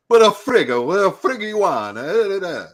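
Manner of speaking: hammed-up Italian immigrant chef; thick Italian accent. Retelling the chef's question, thick Italian accent. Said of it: "he had such a thick accent".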